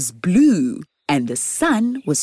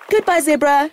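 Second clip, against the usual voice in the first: high call after the zebra; 9 semitones higher than usual. Acted. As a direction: call it out across the field. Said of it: "shouted Oki".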